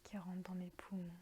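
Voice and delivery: half-whispered calm, drawing out each phrase; guided meditation. Acted. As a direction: guide them gently into their breathing, unhurried. Leads a guided breathing meditation.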